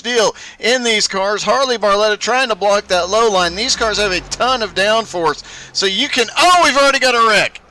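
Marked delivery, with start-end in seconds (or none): 6.4-7.6 quick startled call; surprised. Real, not acted. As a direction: blurt it out, caught off guard. Sudden crash during race commentary.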